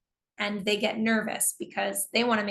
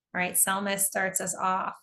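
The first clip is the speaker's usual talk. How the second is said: ordinary talk, nothing marked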